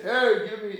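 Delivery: a poor man loudly demanding at the door; demanding call. Retold or imitated. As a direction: bark it like a beggar demanding. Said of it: "he doesn't say".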